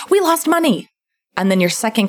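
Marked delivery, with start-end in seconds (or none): 0.1-0.9 panicking self. Retold or imitated. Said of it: "Your panicking self is like".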